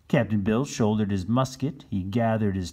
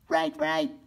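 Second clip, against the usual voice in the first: very high squeaky right; little turkeys chiming in; turkey children. Acted. Turkey children agreeing with their father, very high. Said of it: "said Lavinia and Alfred".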